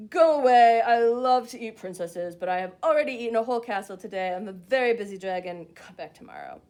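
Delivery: a big bored dragon booming through the door. Acted. Dragon booming at the princess to go away. Dragon answers Elizabeth again.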